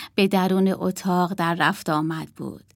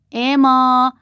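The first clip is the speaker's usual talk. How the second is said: called loud from afar, drawn out; adult calling the girls to tea, loud and drawn out; 6 semitones higher, 7 dB louder, slower than usual. Acted. Calling from another room.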